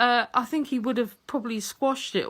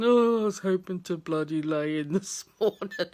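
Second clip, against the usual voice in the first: a lower, grumbling take on her husband; huffing husband; 5 semitones lower than usual. Retold or imitated. Wife imitating her husband grumbling about his lie-in. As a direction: grumble it like a man dragged out of bed. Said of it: "he comes down huffing and puffing".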